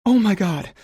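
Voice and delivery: high piercing startled scream. A narrator's reading. Sarah startled by Leo in the dark, screaming. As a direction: shriek it in sudden fright. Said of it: "I hear Sarah scream".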